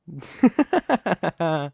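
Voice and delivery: high, smug laugh; enjoy their dismay; amused villain laugh. Acted. Villain laughing after demanding marriage, smug and high.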